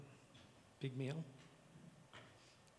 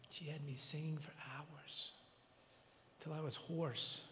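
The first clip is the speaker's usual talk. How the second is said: hushed whisper; 7 dB quieter than usual. Real, not acted. Grieving eulogy for Sarah.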